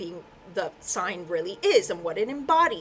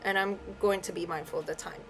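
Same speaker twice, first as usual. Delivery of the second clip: softer, lowered voice, close to crying; on the verge of tears; 3 semitones lower, 8 dB quieter, faster than usual. Real, not acted. Reader moved while speaking of Virgos, close to tears. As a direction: hold back real tears as the voice drops. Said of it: "I'm gonna cry".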